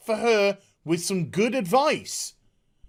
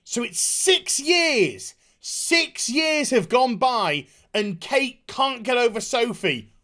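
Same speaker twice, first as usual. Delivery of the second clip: ordinary talk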